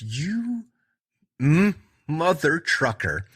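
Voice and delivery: high, slow mock outrage; Brandon's look after the beer in his face, mock fury; silent fury. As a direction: stretch out the outrage. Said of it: "the look of just".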